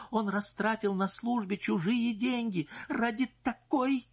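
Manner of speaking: high, heated accusing voice; indignant wife. Acted. Wife accusing her husband's mistress, high indignant voice. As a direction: press the charge hard.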